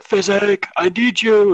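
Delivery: high, loud mock yell calling for help; imitating a scream. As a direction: shout his name like a desperate drunk, half-joking. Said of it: "when he's, like, screaming".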